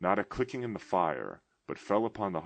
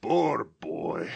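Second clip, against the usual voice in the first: mild pity, close to tears; 7 dB louder than usual. A narrator's reading. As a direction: pity his younger self. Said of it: "in pity for his former self".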